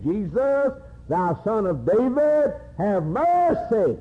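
high, drawn-out preacher's cry; crying out for mercy. Acted. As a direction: call out high, stretching each phrase like a blind beggar pleading. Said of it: "he began crying, saying".

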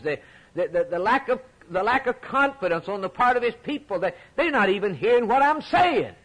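fervent pulpit preaching; preaching at full force. Real, not acted. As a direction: pour it out to the congregation.

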